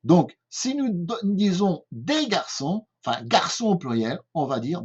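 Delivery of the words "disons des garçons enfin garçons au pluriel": normal talking voice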